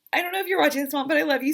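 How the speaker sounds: fighting back tears while speaking to mom; getting emotional. Real, not acted. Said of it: "Sorry, I didn't mean to get emotional".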